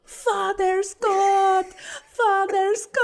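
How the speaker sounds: put-on high, loud, slow kids calling in Belizean accent; belizean kriol. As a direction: call out eagerly like Belizean kids. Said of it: "my really worst Belizean accent".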